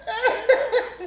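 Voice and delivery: high, loud, laughing through it; high and loud through laughter. Real, not acted. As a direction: crack up telling it. Laughing while retelling.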